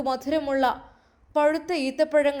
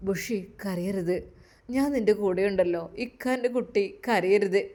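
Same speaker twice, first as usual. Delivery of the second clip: loving comfort; 5 semitones lower, 4 dB quieter than usual. Acted. Husband comforting his crying wife, tender and low. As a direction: hush her gently like a loving husband. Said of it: "സ്നേഹത്തോടെ".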